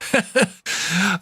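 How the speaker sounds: breathy mocking laughter; real amused laugh. Real, not acted. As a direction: laugh at the audience. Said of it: "I'm laughing at you".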